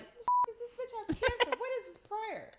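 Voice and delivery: high-pitched incredulous secretary; appalled secretary. Acted. Secretary reading a baffling prayer, high-pitched and incredulous. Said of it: "imagine just being the secretary being like".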